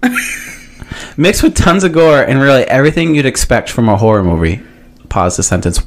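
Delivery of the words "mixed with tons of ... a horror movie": ordinary delivery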